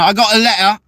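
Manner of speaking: put-on high British accent, "letter" without T's. Showing the British thing against T's, British accent. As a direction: drop the T's in "letter" like a Brit. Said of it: "the British got, like, something against T's".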